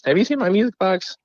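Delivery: high, singsong wife impression; imitated wife. Wife digging through closet asks husband, high mimicked voice. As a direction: pitch it way up as the innocently asking wife.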